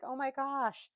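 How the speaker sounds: playfully shocked at bright color; mock alarm. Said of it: "and I'm like".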